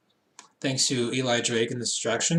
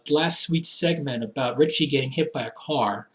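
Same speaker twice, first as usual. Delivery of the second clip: ordinary talk, nothing marked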